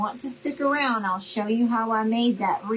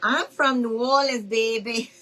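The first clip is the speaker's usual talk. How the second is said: New Orleans accent; 2 semitones higher than usual. Real, not acted. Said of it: "didn't know I had an accent".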